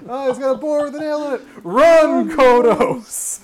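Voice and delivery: high, loud shrieking alarm; panicked alien. Acted. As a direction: scream a warning to flee. Voicing panicked alien fleeing Moe.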